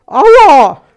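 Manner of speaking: high, loud magpie shriek; pained cry. A narrator's reading. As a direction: yelp as if suddenly pinched. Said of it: "schrie sie auf". (schriller Schmerzensschrei)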